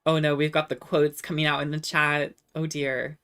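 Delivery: usual speaking voice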